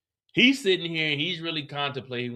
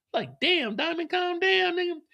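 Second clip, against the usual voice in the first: incredulous. Real, not acted. Host reacting high.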